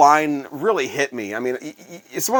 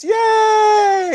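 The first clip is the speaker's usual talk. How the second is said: much higher shouted cheer; crowd cheer. Retold or imitated. The host mimicking thirteen thousand fans cheering.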